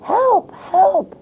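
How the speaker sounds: high, loud panicked cries for help; squirrel shouting. Acted. Squirrel calling out to classroom, panicked high shout. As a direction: shriek it like a terrified squirrel. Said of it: "A squirrel was shouting".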